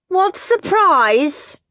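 put-on high, slow demanding girl; shouted. Margaret shouting question to teacher, high demanding. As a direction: drag out the question bossily. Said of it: "shouted Moody Margaret".

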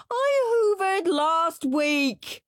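slow, high, drawn-out wail. Acted. Henry wailing at Mum about chores. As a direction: wail it like the world is ending.

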